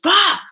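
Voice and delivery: sharp, loud, high burst of a sound; loud bah. Retold or imitated. Acting out cutting the person off, loud bah. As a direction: spit out a dismissive blast.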